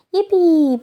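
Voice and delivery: sarcastic cheer. Retold or imitated. Not excited for new year.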